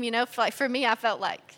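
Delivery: ordinary delivery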